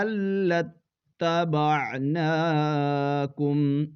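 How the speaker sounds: very slow melodic recitation, stretched vowels; Quran recitation. Shown as a demonstration. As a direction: chant the verse slowly. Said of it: "اس طرح ہجے کریں گے".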